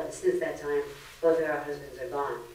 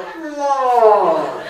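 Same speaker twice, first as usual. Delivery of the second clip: a long, loud, drained "Whoa." with a laugh; deflated whoa; 6 semitones higher, 11 dB louder than usual. Retold or imitated. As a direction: let the air out like your energy was sucked. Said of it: "and you go".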